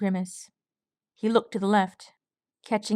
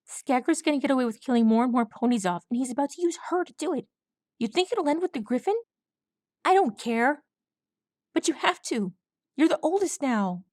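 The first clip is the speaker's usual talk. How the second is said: urgent pleading; 5 semitones higher, faster than usual. Acted.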